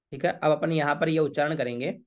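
ordinary talk

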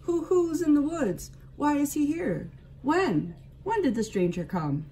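high, hooting owl voice full of questions; questioning owl. A narrator's reading. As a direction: hoot each question up high like a curious owl. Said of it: "asked the owl of many questions".

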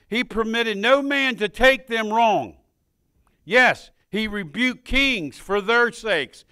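loud, slow pulpit reading; preaching scripture. Acted. Preacher reads First Chronicles to the church, loud and slow. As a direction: read it like a decree.

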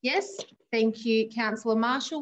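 ordinary talk, nothing marked

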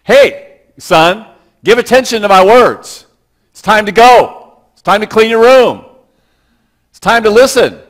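loud, slow calling out to a distracted son. A father calling his son away from the television, loud and firm. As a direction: call your son firmly across the room. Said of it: "we had to lift our voices up".

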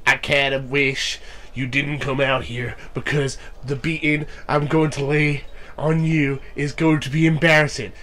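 normal talking voice

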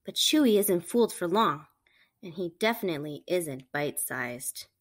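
plain reading voice

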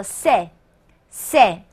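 c'est as one syllable, like 'seh'; elided c'est. Shown as a demonstration. Teacher shows the contracted sound for learners, slow one-syllable c'est. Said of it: "连起来呢就是这样一个音".

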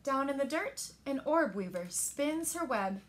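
ordinary delivery

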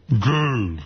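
laughing sound through a pen in the mouth; pen in teeth. Shown as a demonstration. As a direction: mumble it around a pen. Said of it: "Let me put the pen in my mouth".

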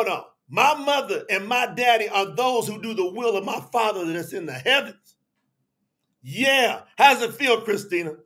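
ordinary talk, nothing marked

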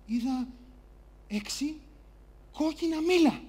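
soft answer broken by long pauses; hesitant answer. Acted. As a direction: give the answer carefully, word by word. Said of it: "Απόλυτη ησυχία. Και ο Γιώργος απαντάει".

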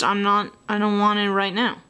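usual speaking voice

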